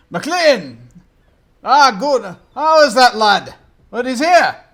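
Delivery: put-on an old man thrilled his lad has come; hearty old gentleman. GM voices delighted old gentleman.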